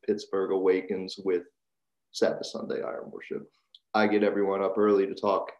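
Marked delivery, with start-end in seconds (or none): 2.1-3.5 eerie whisper, much lower. Shown as a demonstration. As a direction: breathe the title like a secret. Title repeated in a whisper.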